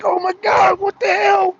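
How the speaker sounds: horrified owner yelling at the guest; shocked outcry. Retold or imitated. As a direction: shout it, high and loud, outraged. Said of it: "And he's like".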